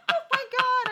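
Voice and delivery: high-pitched laughing exclamation; cracking up. Real, not acted. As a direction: say it through helpless laughter. Cracks up recalling the drink scene.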